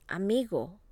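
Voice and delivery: "Amigo" with the weight on MI; stress next to last syllable. Shown as a demonstration. Teacher models the rule for repeating, stress next to last syllable. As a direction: lean on the middle syllable of "Amigo". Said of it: "stress next to the last syllable".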